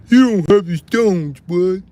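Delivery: high, loud mocking voice. Streamer mocking the chatter's words, high and loud. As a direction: throw the line back as a jeering caricature.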